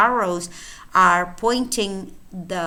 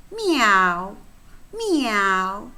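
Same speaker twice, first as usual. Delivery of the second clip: kitten mewing; much slower than usual. Acted.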